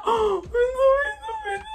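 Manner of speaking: very high mock crying wail; hammed-up wailing. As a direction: wail in mock despair at being hung up on. He hangs up on her.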